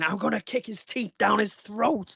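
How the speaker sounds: half-acted furious threat; retold rage. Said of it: "I was raging for about eight hours".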